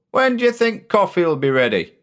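a hint of a hungry ship's cook; cook's voice. The cook asks.

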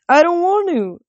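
high, pleading reluctance; distressed refusal. A narrator's reading. Satyr refusing to release his dying god, pleading.

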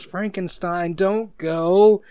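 lightly voiced sister begging him to stay; little girl pleading. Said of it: "she goes".

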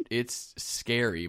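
normal talking voice

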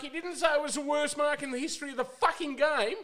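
indignant outburst. Real, not acted. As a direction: blast the idea as ridiculous. Indignant rant about the comments.

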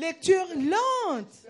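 "lente" drawn out, high and loud; emphatic stress. Real, not acted. Interpreter echoing the preacher's emphasis, drawn-out "lente". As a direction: stretch "lente" like the preacher's long word. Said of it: "Moi, je mets l'accent sur la".

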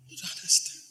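wife's line repeated in a whisper. Retold or imitated. Pastor retelling his wife at the hospital, whispered. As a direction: breathe it out, barely voiced.

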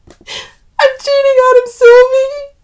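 high, loud, slow wail; sob-shout the confession at a friend; bursting out crying. A narrator's reading. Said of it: "she bursts out".

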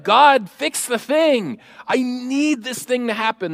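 loud, high, insistent plea; demanding prayer. Acted. Pastor mimicking a prayer to fix the thing, demanding.

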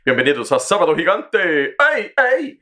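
over-the-top Spanish TV host; lucha libre announcer. Pretend. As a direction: belt it out like Sábado Gigante.

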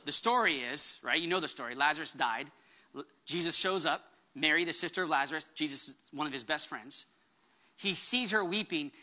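ordinary talk

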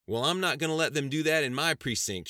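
loud, high country drawl; country boy drawl. A narrator's reading. Sheriff defying federal orders, loud country drawl. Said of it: "blurted out in his characteristic country boy drawl".

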